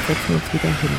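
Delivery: usual speaking voice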